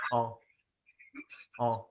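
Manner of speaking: nasal "en" sound through the nose. Shown as a demonstration. Teacher showing the nasal "en" to students. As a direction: say "en" with the air in the nose. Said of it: "so the first thing you should know".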